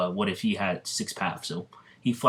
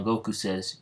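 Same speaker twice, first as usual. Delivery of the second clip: ordinary delivery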